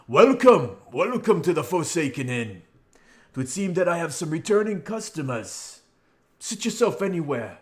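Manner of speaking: high, booming welcome to returning guests; loud, jovial innkeeper. Acted. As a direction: play a hearty innkeeper calling out a welcome. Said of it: "a much louder and more jovial".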